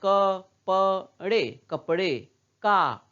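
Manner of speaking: क, प, ड़े each sounded whole, one by one; full sounds. Shown as a demonstration. Teacher reading full letters to children, syllable by syllable. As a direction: spell out कपड़े syllable by syllable with firm stops. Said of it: "तो इसको हम पूरा पढ़ रहे हैं".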